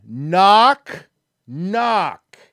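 loud, drawn-out mock-impatient knocks; insistent repeat. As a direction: stretch each word, high and loud, pestering. Exasperated repeat in retold message exchange.

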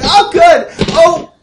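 high, loud chirpy question; Tiffany. Acted. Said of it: "she's like".

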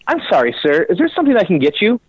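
high, polite service voice; mock-courteous, pitched well up; apologetic bartender. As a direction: imitate a bartender suddenly eager to help. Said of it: "the lady behind the register".

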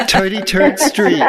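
thick New York accent, "Toady Turd Street" with hard T sounds. Shown as a demonstration. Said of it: "Hear my New York accent?".

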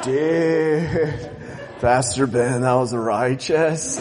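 low, slow surfer drawl, laughing; surfer dude. Acted. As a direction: lay back like a stoked surfer. Surfer voice to pastor.

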